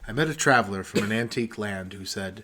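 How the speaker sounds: solemn recital through a laugh; poem recitation. Acted. Reciting the poem again while friends heckle. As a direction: recite it gravely, fighting a giggle.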